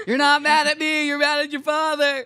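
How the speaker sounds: high, teary laughing voice; laugh-cry the words. Pretend. Mock therapy, crying laugh.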